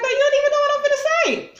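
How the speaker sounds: very high, loud, fast exasperation; shrill mock argument voice; high exasperated mock voice. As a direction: squeal it out in disbelief. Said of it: "It's like".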